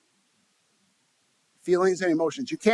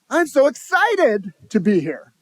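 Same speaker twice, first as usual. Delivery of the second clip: higher, louder and slow, full of real excitement; genuinely excited; 7 semitones higher, 6 dB louder than usual. Shown as a demonstration. Speaker demonstrating tone to the audience, genuinely excited. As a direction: sell the excitement with your whole voice. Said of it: "thirty-eight percent is the tonality of your voice".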